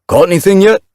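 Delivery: high, loud, cheerful call; kittypet voice. A narrator's reading. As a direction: call out brightly like a young pet cat. Second kittypet calls out.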